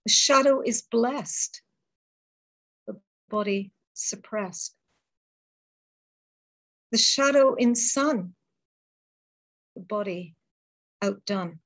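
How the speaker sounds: measured, solemn poetry reading with long pauses; poem recital. Acted. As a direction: let each line land, then hold the silence. Reads her poem aloud.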